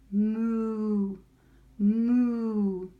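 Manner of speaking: loud, drawn-out moos; mooing cow. A narrator's reading. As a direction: moo the word like a cow. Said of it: "said Cow quickly".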